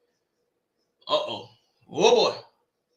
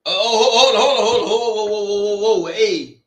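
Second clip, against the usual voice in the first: excited disbelief; 5 dB louder than usual. Real, not acted. Reacting to a big play.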